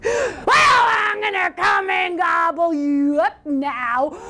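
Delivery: slow, drawn-out menacing shout; troll's roar. Acted. Troll threatening to gobble up the goat, roaring. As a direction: roar a threat, dragging out the words. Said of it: "roared the troll".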